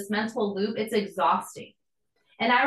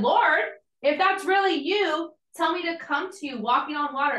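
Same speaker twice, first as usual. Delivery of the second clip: calling out to Jesus; 6 semitones higher than usual. Retold or imitated. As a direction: call out boldly, half in awe.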